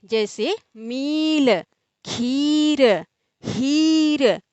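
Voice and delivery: ई drawn out long in मील, खीर, हीर; long drawn vowel. Shown as a demonstration. Teacher showing the long ई to students, stretched vowels. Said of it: "आराम से बोला जाता है".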